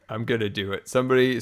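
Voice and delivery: ordinary talk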